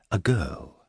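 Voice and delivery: plain reading voice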